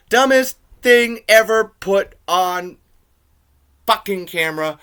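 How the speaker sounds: loud, slowed-down scorn; scornful yelling at the film; angry rant. Real, not acted. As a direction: spit out the insult.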